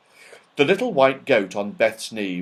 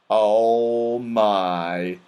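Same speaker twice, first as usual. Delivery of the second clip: groan; 3 semitones lower than usual. Acted. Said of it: "he groaned".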